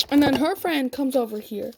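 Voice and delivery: ordinary talk, nothing marked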